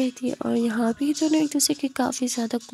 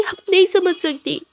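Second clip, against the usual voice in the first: higher, louder tearful outburst; crying; 7 semitones higher, 5 dB louder than usual. Acted. Ananya cries to Khushi about home, sobbing. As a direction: plead through tears to be understood. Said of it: "ज़ोरों से रोने लगती है".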